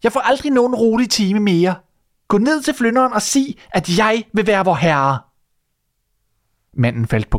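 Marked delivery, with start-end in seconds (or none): 0.0-5.2 high, insistent demanding yell; the wife orders her husband to go; shouting wife. Acted. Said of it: "råbte hun". (krævende råb, høj stemme)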